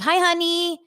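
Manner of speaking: high, lilting mock of a husband's hi honey; imitating Mauricio. Husband greeting his wife, lightly imitated, high and sugary. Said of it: "he talks like that".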